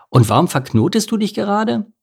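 high, eager question; animated, climbing question; excited. A narrator's reading. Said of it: "erwiderte Lars aufgeregt".

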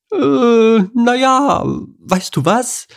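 high, hesitant animal voice; sheepish cartoon hen voice; nervous character voice. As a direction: squirm out of it up high. Henriette backing away nervously.

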